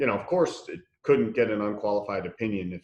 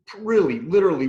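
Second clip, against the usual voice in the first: ordinary talk, nothing marked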